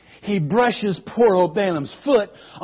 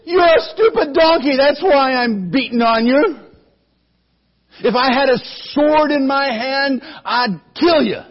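high, loud angry shouting; shouting threats; furious shout; 6 semitones higher, 4 dB louder than usual. Acted. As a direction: rage at the donkey. Furious rider shouting at donkey.

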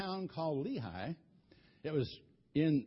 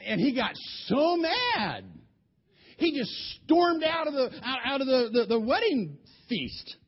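angry telling; 5 semitones higher, 5 dB louder than usual. A narrator's reading. Samson storming out angrily.

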